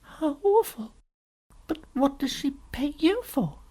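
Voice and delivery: put-on high child's voice, dismayed; concerned little girl. Lucy asking what the Witch pays for, high and concerned.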